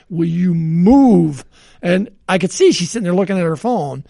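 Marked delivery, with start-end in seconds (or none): 0.1-1.4 slow, drawn-out exasperated demand; annoyed. Retold or imitated. Driver stuck behind a lady on her phone, slow exasperated demand. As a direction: stretch it out, fed up with the driver. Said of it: "It's like".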